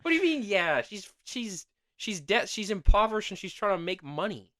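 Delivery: high, heated defence; indignant. Real, not acted. Host defends the relative to cohost, high and indignant. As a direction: argue for her hard.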